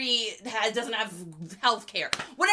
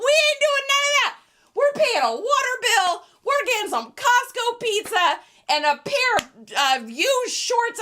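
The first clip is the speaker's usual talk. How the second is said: much higher and louder, fired up; indignant shout; 11 semitones higher, 8 dB louder than usual. Real, not acted. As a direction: hammer it home to Goodwill. Angry rebuttal to Goodwill.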